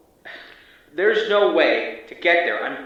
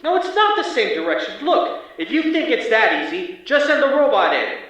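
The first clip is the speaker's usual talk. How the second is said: much higher and louder, furious pushback; angry argument; 10 semitones higher, 5 dB louder than usual. Acted. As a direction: shout down the people giving orders. Agent arguing with command.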